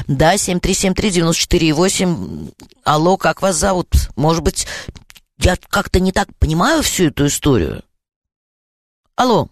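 ordinary delivery

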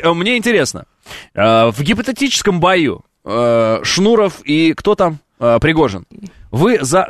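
usual speaking voice

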